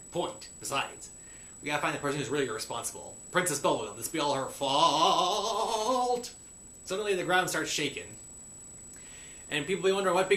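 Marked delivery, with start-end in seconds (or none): 4.0-6.3 last word stretched very long, rising; hammed-up, slow rising blame; drawn-out accusation. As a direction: stretch "fault" out dramatically.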